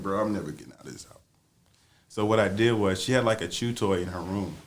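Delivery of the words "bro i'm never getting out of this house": fast hushed mutter. Retold or imitated. Stuck hiding in closet.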